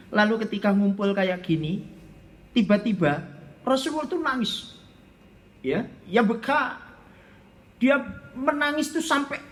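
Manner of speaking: usual speaking voice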